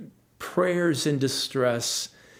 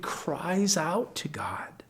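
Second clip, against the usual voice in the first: whisper; 3 semitones higher, 6 dB quieter, slower than usual. Real, not acted. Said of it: "unvoiced whisper".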